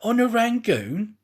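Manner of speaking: hammed-up surprise, slow on the question; feigned surprise. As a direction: play-act amazement at the chance meeting. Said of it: "with every appearance of extreme surprise".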